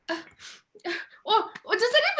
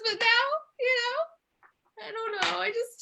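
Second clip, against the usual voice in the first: normal talking voice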